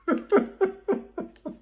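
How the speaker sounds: high bursting laugh. Real, not acted. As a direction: crack up at your own bit. Laughing at own impression.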